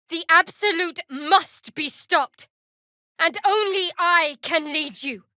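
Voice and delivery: high, loud zealous declaration; fervent proclamation. Acted. Rallying companions against the Absolute, fervent proclamation. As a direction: proclaim it like a chosen leader.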